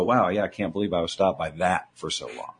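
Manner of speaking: understated, detached wonder, voice dropped low; emotional detachment. Acted. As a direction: lightly act out looking back from a distance. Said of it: "look back at that story with emotional detachment".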